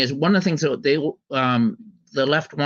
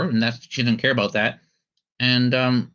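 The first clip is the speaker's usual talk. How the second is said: usual speaking voice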